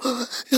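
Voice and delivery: sharp acted intake of breath; stressed gasp. Believer running around with a bad conscience, mock gasp. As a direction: gasp as if suddenly overwhelmed. Acting out rushing about stressed.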